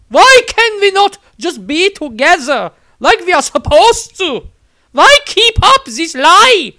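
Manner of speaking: high, loud, syrupy nun voice; zealous nun. A narrator's reading. Nun Angelique sweetly insists to the wizard, high and loud.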